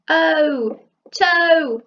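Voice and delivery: excited reveal. Real, not acted. Reveal of the mystery box.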